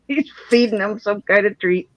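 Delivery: ordinary talk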